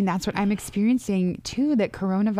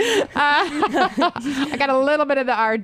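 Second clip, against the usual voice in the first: high, laughing while speaking; 7 semitones higher than usual. Real, not acted. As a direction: chuckle through the words. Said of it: "laughs about her rolled r".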